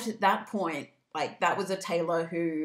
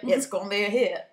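put-on Nashville accent. Label boss touting the pop version, Nashville accent. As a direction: play a Nashville Big Daddy boss. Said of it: "I don't know why they had that accent".

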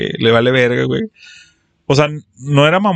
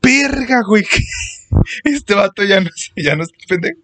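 mock awe; 7 semitones higher than usual. Class reacting in awe.

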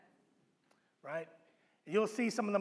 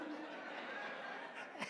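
scoffing laugh; 16 dB quieter than usual. Retold or imitated. Disciples scoff at Jesus' question.

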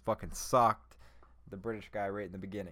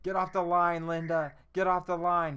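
higher and louder, barking down the phone; mock yell; 6 semitones higher, 8 dB louder than usual. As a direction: yell at him to hang up. McConaughey yells down the phone.

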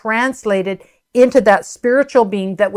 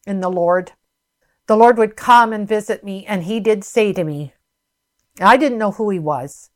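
normal talking voice

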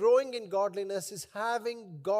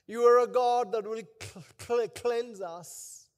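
prayer. Acted. Leads a prayer.

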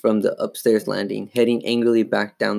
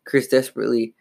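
ordinary delivery

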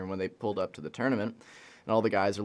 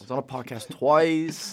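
cocky bragging voice; 5 semitones higher, 6 dB louder than usual. Retold or imitated. Joining the mock brag.

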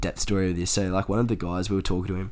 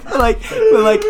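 high, loud, laughing restart; talking through laughter; 9 dB louder than usual. Real, not acted. Storyteller resuming after cracking up, talking through laughter. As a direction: fight the giggles to carry on.

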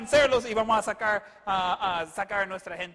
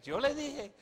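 high, softer sing-out; mock I-told-you-so; 5 semitones higher, 6 dB quieter than usual. Quoting what women say, softer mock I-told-you-so. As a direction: quote the catchphrase high and small.

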